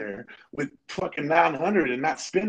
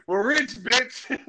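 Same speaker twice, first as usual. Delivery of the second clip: slow, high, laughing through the punchline; gleeful, laughing punchline; 9 semitones higher, much slower than usual. Acted. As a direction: drag it out slowly while cracking up.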